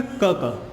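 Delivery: K said as a short "क" sound; letter sound demo. Shown as a demonstration. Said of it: "के के लिए क्या use करेंगे".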